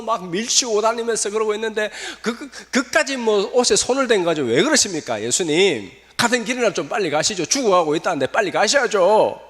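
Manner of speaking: high, half-laughing complaint, pleading to hurry; a disciple pushing Jesus to hurry up with a laugh; exasperated disciples. Acted. Disciples protest, rushing Jesus.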